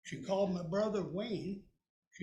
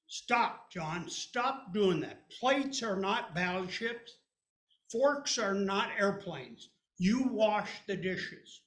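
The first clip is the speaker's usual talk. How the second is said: louder, slow bossy scold; sister scolding; 6 dB louder, slower than usual. Retold or imitated. Sister scolding John for playing with dishes. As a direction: lay down the rules firmly.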